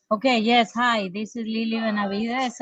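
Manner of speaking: normal talking voice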